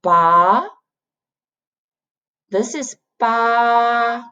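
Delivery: ba rising at the end; rising second tone. Shown as a demonstration. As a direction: pull ba upward slowly. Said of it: "this pronounced like".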